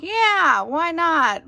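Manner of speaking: high, loud, eager quote of oneself; breezy enthusiasm. Retold or imitated. Vlogger quoting herself on buying bilingual books, breezy enthusiasm. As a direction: play up a carefree yes. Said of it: "I'm like".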